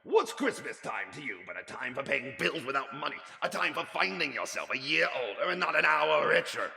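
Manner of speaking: put-on loud, high scornful old miser rant; grumpy rant. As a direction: rail against Christmas bitterly. Scrooge rants at nephew.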